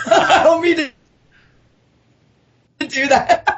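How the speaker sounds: high, giggly aside; laughing apology. Real, not acted. Author excusing her own little book plug, laughing. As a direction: laugh your way through the words.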